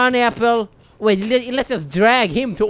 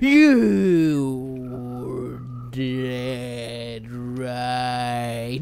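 very slow, deep warped drawl; 11 semitones lower, 6 dB quieter, much slower than usual. Acted. Gamer mocking a dead enemy, slowed warped drawl. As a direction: melt the words down like slow motion.

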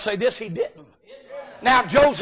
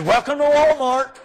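chirpy greeter; 3 semitones higher, 3 dB louder than usual. Pretend. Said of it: "hand somebody a buggy".

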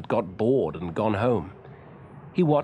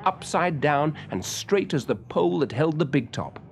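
ordinary delivery

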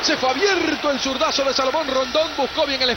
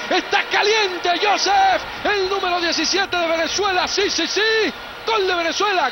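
breakneck goal commentary; 6 semitones higher than usual. Real, not acted. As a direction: roar the goal like the stadium erupted.

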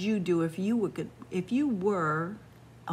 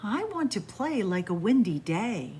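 slow, lilting storybook read; reading to children; slower than usual. Acted. Storyteller reading the windy-day book to kids, lilting. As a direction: savour each image for little listeners.

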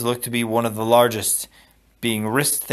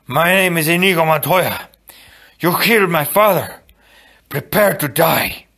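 loud, higher swordsman's vow, slowing on the last words; ringing avenger's challenge; heroic vow; 6 semitones higher, 5 dB louder than usual. Acted. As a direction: declare it proudly to the father's killer. Inigo's famous planned speech.

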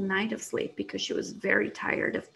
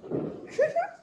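very high tiny giggle; a small hidden creature giggling; little giggle. Acted. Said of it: "she heard a little giggle".

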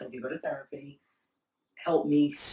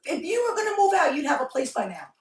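exasperated retort; 8 semitones higher, 3 dB louder than usual. Retold or imitated. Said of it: "I remember saying".

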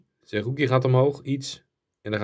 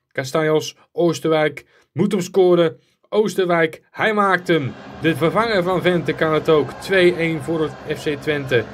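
raised, urgent play-by-play building to the goal; excited goal call; 5 semitones higher, slower than usual. Real, not acted. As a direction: shout the winning goal like a commentator. Commentating as his team scores.

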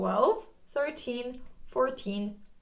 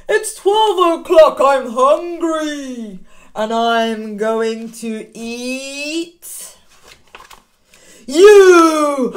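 slow, louder menacing wolf, booming on you; big bad wolf; 2 semitones higher, 11 dB louder, much slower than usual. Teacher as the wolf springing the scare on the children, slow then booming. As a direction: build slowly then pounce on the last word. Wolf's scary punchline.

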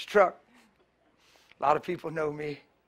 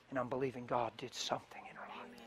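whisper; 4 semitones lower, 12 dB quieter than usual. Real, not acted. Hushed closing thought.

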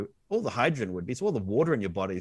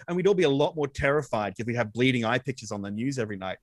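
normal talking voice

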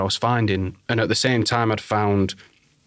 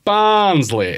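"Barnsley?" high and loud in a mock Yorkshire accent; a southerner mimicking the Yorkshire "Barnsley?"; mocking Yorkshire echo; 10 semitones higher, 6 dB louder than usual. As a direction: parrot "Barnsley?" back in a put-on Yorkshire accent. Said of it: "repeat what I say in as if they're in my accent".

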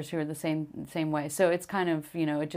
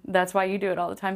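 normal talking voice

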